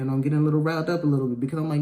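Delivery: ordinary talk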